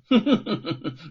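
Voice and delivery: short, pleased official's chuckle; gloating chuckle of a magistrate; smug laugh. Acted. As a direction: laugh as if you've caught him out. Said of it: "十分得意".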